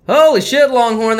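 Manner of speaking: ordinary talk, nothing marked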